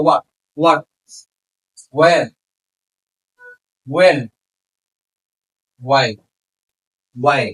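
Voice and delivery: "what, where, when, why" each begun with a plain w; silent H. Shown as a demonstration. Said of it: "এইচটা silent থাকবে".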